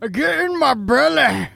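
loud, high Scottish character yell; Scottish accent. Acted. Put on the spot for Irish, yells in Scottish accent. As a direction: bellow it in a hammed-up Scottish accent. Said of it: "That bastard was Scottish too".

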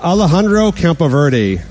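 Alejandro Campoverde said slow and anglicized; deliberately mispronounced. Shown as a demonstration. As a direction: Alejandro Campoverde in a flat gringo reading.

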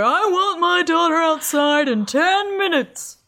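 high, slow put-on character voice; hammed-up, stretched-out demand; Ferris Bueller guy. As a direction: do an exaggerated movie-character impression, drawn out. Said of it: "you sound like the guy from Ferris Bueller".

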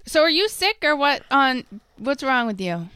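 usual speaking voice

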